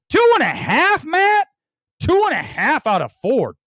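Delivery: incredulous yell at the critic; outraged shout. Real, not acted. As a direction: yell it high in disbelief.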